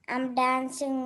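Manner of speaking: ordinary delivery, nothing marked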